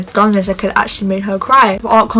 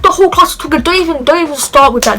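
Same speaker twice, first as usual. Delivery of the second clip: higher, louder indignant comeback; retold angry retort; 4 semitones higher, 4 dB louder than usual. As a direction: snap back at the accusing classmates. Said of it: "I was like".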